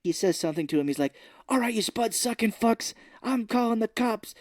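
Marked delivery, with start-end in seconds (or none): 1.5-4.3 high-pitched mock Irish tirade; Irish accent. Retelling his threat to the mob, in a mock Irish accent. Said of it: "does an Irish accent to him".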